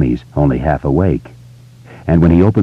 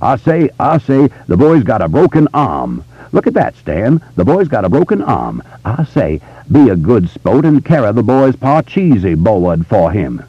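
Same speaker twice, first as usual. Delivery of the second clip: grandly rolling Southern gentleman voice, higher; Foghorn Leghorn Southern drawl; 5 semitones higher than usual. Retold or imitated. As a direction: ham up a cartoon Southern rooster gentleman. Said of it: "his grandly rolling Southern gentleman voice".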